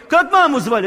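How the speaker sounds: высокий, громкий голос старого друга; допытываться громко и высоко; напористый расспрос. Retold or imitated. Old friend quizzes him excitedly. (напористо, почти криком)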